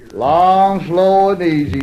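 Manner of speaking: easygoing mountain talk, words held long; long and easy. Shown as a demonstration. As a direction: stretch it out, nice and easy. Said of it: "that's just the way we talk".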